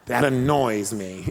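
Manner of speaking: slow, low mock-feeble complaint; lightly mocking wimpy drawl. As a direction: imitate someone barely annoyed. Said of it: "That seemed, like, weird and wimpy".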